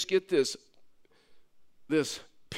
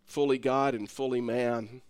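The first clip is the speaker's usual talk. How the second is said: sinner's prayer; 7 semitones lower, 6 dB quieter than usual. Acted. Pastor leading the congregation in prayer, soft and low. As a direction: pray it humbly, leading them line by line. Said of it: "Just ask him".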